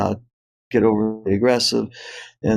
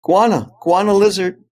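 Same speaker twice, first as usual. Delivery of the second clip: higher, louder call of alarm; retold yell, pitched up; imitated child's yell; 7 semitones higher, 5 dB louder than usual. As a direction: call it out like a daughter spotting danger. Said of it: "she yells".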